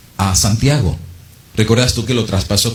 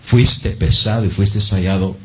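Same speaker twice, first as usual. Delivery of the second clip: slow, grave judgment; solemn verdict; 4 semitones lower, slower than usual. Acted. Preacher delivers God's verdict to the hearer, slow and grave. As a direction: pronounce it like a sentence from heaven.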